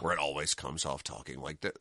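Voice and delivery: very low, soft fake American accent; genericized American accent. Imitating British actors trying American, low genericized American accent. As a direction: drop deep and quiet into a put-on generic American accent. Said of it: "weird, like, genericized American accent".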